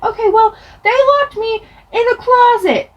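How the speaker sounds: high, whiny acted protest; indignant protest. Child blaming brothers to mom, indignant protest.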